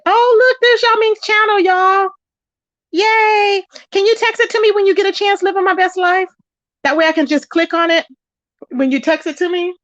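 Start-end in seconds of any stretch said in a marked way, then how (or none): 0.1-5.0 suddenly high and loud, delighted; light up at spotting the channel; thrilled to see a friend's channel pop up in chat; excited. Real, not acted. Spots friend's new channel live.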